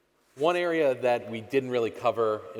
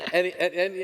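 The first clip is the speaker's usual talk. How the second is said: laughing; 8 semitones higher, 3 dB louder than usual. Real, not acted. Laughing while asking.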